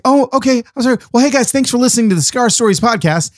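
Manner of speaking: high, put-on polite sign-off voice; flustered podcast host. Imitated host abruptly ending the recording, high polite voice.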